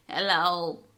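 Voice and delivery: lightly put-on old lady; a hint of mom's old-lady act. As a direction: answer the phone as a feigned old lady. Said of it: "she put on her old lady voice".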